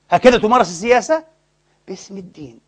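ordinary talk

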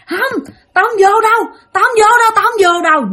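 acted high-pitched cries of refusal; yelling in protest. Possessed boy dragged to church, high yelling protest. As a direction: yell repeated refusals like a struggling child. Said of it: "nó la quá trời la".

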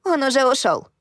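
put-on high, squeaky little-girl voice; piping childlike tone. A narrator's reading. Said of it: "с детски песклявым голосом".

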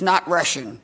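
ordinary talk, nothing marked

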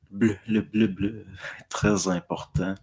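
"bleu" pronounced syllable by syllable, laughing; Bombardier's precise diction. Retold or imitated. Host laughing while mimicking Denise Bombardier's overly precise diction. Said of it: "Elle prononce, là, chaque syllabe".

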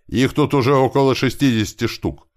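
loud, high-pitched call; yelling. Acted. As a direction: shout a report across to friends. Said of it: "вновь заорал он от машин".

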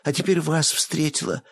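high eager begging; plead, rising with excitement. Acted. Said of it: "взмолилась журналистка".

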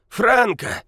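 high, loud ingratiating call; fawning call. Acted. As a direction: call out, begging for attention. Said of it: "раздался заискивающий голос".

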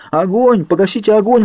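high, urgent yell; panicked shriek, pitch way up; screaming. A narrator's reading. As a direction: cry out in burning agony. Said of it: "вопила я".

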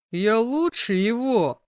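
loud, drawn-out cocky call; slow, loud show-off boast; giggling boast. A narrator's reading. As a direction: brag it out loudly and slowly. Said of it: "хихикнул рыжеволосый детина".

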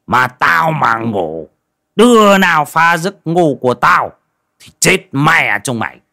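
drawn-out, irritable threats from inside; cranky, menacing village sorcerer; grumpy shaman. A narrator's reading. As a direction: growl at whoever woke you. Grumpy shaman woken, answers from inside.

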